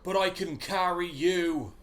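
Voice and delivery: put-on a loyal hobbit in a West Country accent, drawn out and earnest; Samwise Gamgee. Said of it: "Samwise Gamgee".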